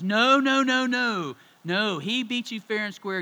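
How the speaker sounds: loud, high, drawn-out string of protests; protesting mom. Retold or imitated. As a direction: shout it like a mom stepping in to overrule. Mom breaks up driveway game.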